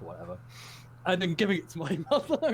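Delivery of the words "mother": usual speaking voice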